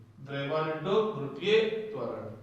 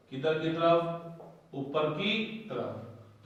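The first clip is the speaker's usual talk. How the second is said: slow and soft; 5 dB quieter, much slower than usual. Shown as a demonstration. Teacher asks and answers.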